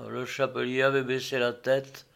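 usual speaking voice

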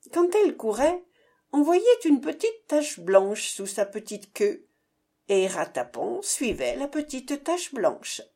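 ordinary delivery, nothing marked

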